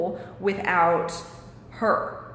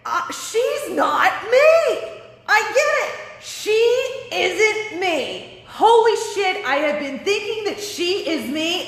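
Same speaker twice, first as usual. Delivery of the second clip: high, loud, amazed outburst; mother suddenly seeing it all, high and thrilled; excited realization; 8 semitones higher, 5 dB louder, slower than usual. Retold or imitated. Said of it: "she was like, gasps".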